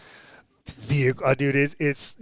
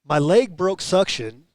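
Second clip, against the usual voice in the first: higher, louder animated retelling; retold exasperated racer; 5 semitones higher, 4 dB louder than usual. Imitating Cody explaining how his leg broke suction, animated exasperation. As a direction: act out a racer's mishap with mock exasperation. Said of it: "he's like".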